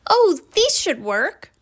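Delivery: put-on a kind girl offering help; voiced young girl. A narrator's reading.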